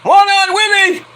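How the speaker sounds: slow, high-pitched yell; high shout. Retold or imitated. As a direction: belt it out like a kid. Shouted movie quote.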